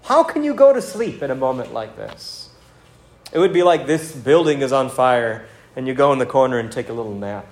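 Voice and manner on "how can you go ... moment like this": incredulous captain. Retold or imitated. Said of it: "It's kind of like".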